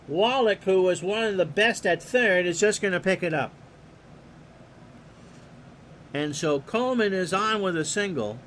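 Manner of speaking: steady announcer call of the action; play-by-play. Acted. Announcer calling the pitch to the listeners, play-by-play. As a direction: call the game like a radio announcer.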